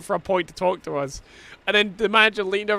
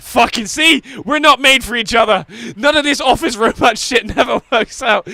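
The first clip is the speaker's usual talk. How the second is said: loud and higher, playful; mock triumphant shout; 7 semitones higher, 8 dB louder than usual. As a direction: bellow it as a cheeky joke.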